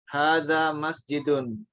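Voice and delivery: Hadza masjidun read slowly, each syllable clear; slow Arabic reading. Shown as a demonstration. Teacher slowly reading Arabic to adult students. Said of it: "Makanya dia namanya masjid".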